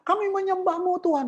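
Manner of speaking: high, hammed-up mock praise; mocking fake worshipper. Preacher mocking a worship leader's empty praise, high mock voice. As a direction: parody a hollow worship leader's cry. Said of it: "Kamu lapor".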